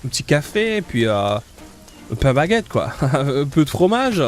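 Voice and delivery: lazy mock Parisian French accent; easygoing French accent from France, played for laughs. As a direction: put on a laid-back French-from-France accent. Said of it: "if I try to do a French accent".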